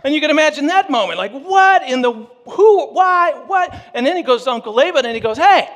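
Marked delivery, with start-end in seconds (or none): 1.3-3.7 high, loud, sputtering bewildered questions; stunned confusion. Retold or imitated. Jacob waking beside the wrong bride, shouting in shock. 5.4-5.7 high, loud protest over a broken deal; indignant complaint. Retold or imitated. Jacob confronting Uncle Laban over the swapped bride, loud and indignant.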